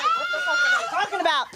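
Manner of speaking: high, fast baffled outburst; high fast bewildered squeak. Retold or imitated. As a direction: squeal the confusion. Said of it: "I'm like".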